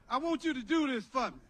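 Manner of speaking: ordinary talk, nothing marked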